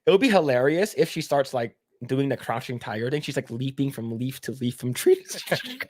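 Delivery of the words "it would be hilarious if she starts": normal talking voice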